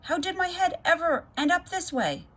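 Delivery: put-on high, distressed little girl voice; upset girl. A narrator's reading. Girl wailing about her sprouting head. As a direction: wail it in dismay. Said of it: "she screeched".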